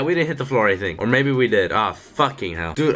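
usual speaking voice

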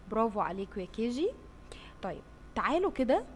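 ordinary talk

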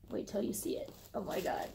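soft whispered tease; teasing whisper. Real, not acted. Vlogger whispering to viewers before revealing the strap.

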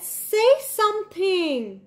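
put-on upset boy begging his penguin, slow and high; upset plea. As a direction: beg the silent penguin to talk. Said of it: "he got a little more upset and he said".

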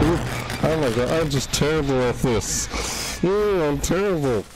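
high, wailing self-reproach; dismayed whine. Real, not acted. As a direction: moan about your own failure. Despairs at own mistakes.

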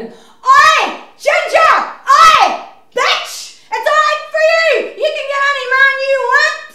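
shouted heckle. Retold or imitated. Comic imitates a plane passenger yelling insults across the aisle, shrill jeering shout. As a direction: bellow it across the aisle at her.

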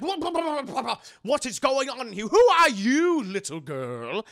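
loud, high, alarmed what-what-what; startled shouting. Retold or imitated. Henry yelling at the girl he finds in his room. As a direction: burst in yelling in shock. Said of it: "he's like".

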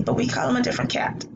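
usual speaking voice

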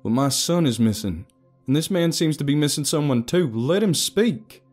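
urgent appeal; forceful protest. A narrator's reading. Father confronts a hostile room.

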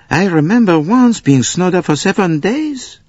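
a high, chatty conductor; high character voice. Acted. Conductor recalling being snowed up, high voiced. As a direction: raise the voice for the conductor.

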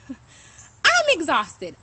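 "I'm exhausted" stretched very slowly through a laugh; laughing exhaustion. Real, not acted. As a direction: laugh while dragging out "exhausted". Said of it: "aggressive at the same time".

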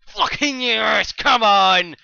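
slow, drawn-out high shout; real jubilant yell; triumphant roar. Real, not acted. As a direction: bellow it after scoring. Celebrates a goal in game.

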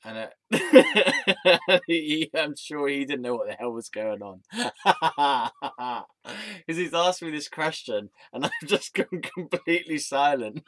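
loud, high, laughing through the words; cracking up mid-story; laughing while talking. Real, not acted. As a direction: tell it through real laughter. Cracks up at own awkward silence.

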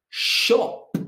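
"shop" with a long sh; stressed initial sh. Shown as a demonstration. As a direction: lean on the opening sh of "shop". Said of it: "words that begin with sh".

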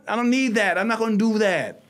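ordinary talk

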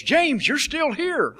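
high, slow, drawn-out surprise; the governor spotting him again, high and slow; surprised greeting. Retold or imitated. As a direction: let the surprise stretch out.

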